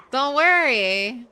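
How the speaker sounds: sarcastic giggling reassurance; laughing. Pretend. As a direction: laugh while mock-reassuring.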